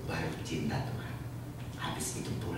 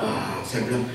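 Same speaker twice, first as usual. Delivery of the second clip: ordinary talk, nothing marked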